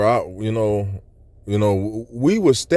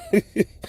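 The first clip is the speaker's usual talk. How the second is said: high little chuckle; brief amused laugh at the memory; short laugh; 9 semitones higher than usual. Real, not acted. Recalls wanting to burst out laughing.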